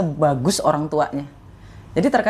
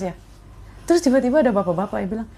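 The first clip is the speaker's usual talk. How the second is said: ordinary delivery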